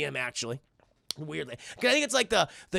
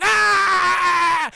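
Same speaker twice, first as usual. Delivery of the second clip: long, loud, high scream; mock frightened scream; 12 semitones higher, 11 dB louder than usual. Eustace fleeing Bigfoot, mock frightened scream. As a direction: scream and hold it in comic fright.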